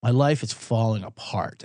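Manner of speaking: slow, low, mock-doomed lament; hammed-up gloom about his own fate; mock despair. Host alone in the booth, mock despairing and slow. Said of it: "And I'm like".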